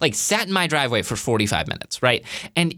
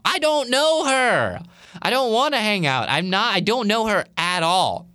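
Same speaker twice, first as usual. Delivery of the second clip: high, whining inner objection; exasperated protest; 8 semitones higher than usual. Retold or imitated. Speaker silently refusing to hang out with a stranger, exasperated. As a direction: stretch the words in retold dismay.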